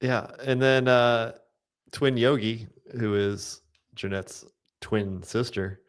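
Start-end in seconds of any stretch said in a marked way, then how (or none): none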